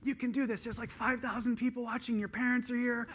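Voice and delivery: fired-up pep talk. Retold or imitated. Young coach firing up Katie before her race, loud and hyped. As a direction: pump her up like a frantic young coach about to send her into the race. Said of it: "and I was like".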